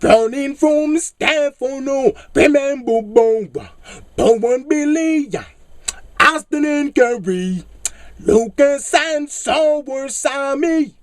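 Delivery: mock soap drama. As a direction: announce the names like a melodrama. Dramatic soap opera names.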